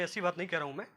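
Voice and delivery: usual speaking voice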